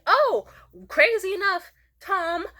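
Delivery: high, loud-starting mock surprise; bluffing recovery. Covering a blunder with Tom, high and fake-surprised. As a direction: bluff brightly with a high, drawn "oh".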